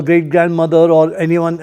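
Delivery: normal talking voice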